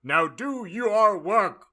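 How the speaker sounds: high, slow, bossy order; stern command. Acted. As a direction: bark it like a stern official. Fudge commands students.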